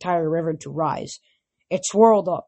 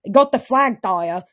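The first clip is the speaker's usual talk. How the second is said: loud defiant shout; angry retort; 3 semitones higher, 5 dB louder than usual. A narrator's reading. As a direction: throw it back at her. Hero angrily answers Thalia.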